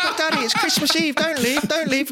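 put-on high pleading child voice; begging kids. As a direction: whine like little kids begging. Kids begging dad to stay.

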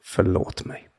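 "Förlåt mig" whispered softly, no voice at all; a tender whisper of the longed-for words; whispered apology. Retold or imitated. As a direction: son recalling his mother's apology, whispered. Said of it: "ropar orden jag längtat efter".

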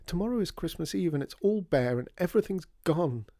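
high, plaintive lament; tearful wail. A narrator's reading. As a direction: pile up the losses, wailing. Tearful Peony laments bare Christmas.

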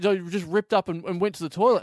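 normal talking voice

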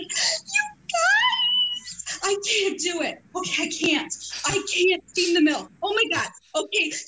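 hammed-up squealy overwhelmed barista; Margot. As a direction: squeal it like a flustered new barista. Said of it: "Can I do a Margot impersonation".

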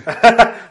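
loud laugh. Real, not acted. Burst of laughter.

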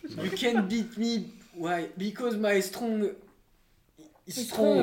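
slow, laboured mock-English accent; overdone French-accented English; Inès's English accent. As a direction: drag out each word in clumsy accented English. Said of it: "c'est l'accent de Inès".

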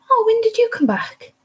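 suddenly notice him there, bewildered; dazed surprise. Retold or imitated. Retelling her confused moment in labour.

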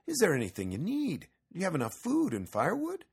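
high, eager offer of help; Axel. A narrator's reading. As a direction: pitch it up, questions rising at the ends. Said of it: "Axel said".

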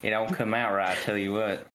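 folksy southern accent, dropped a little. Shown as a demonstration. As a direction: put on a southern twang. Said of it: "whenever I'm trying to do the accent".

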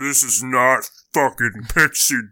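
slow, loud, strained raging cry; enraged rant. Acted. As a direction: play a crazed man losing it at a pixie. Crazed man raging at pixie.

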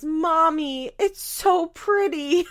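put-on high, loud sweet child voice; Isaac imitated gushing to his mom; little boy voice. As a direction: gush to mommy like a small boy. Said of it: "he goes".

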